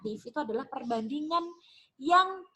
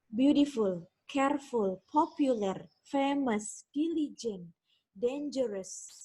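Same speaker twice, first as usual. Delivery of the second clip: ordinary talk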